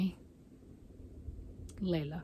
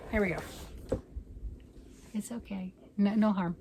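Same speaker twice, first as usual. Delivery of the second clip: normal talking voice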